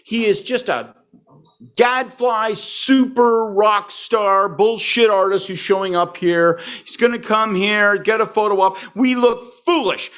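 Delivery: high, slow, emphatic mock panic; imitated freak-out. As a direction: play an aghast official, hammering each word. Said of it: "like, freaking out, going".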